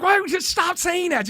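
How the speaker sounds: high-pitched imitation of an angry woman; mock indignant. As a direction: protest in a high, exasperated mock voice. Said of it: "now this lady's mad".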